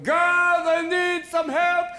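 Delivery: loud, high, drawn-out plea; calling to God. Acted. Moses begging God for help, loud drawn-out call. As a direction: cry out to heaven, stretching the words. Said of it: "Moses called to God going".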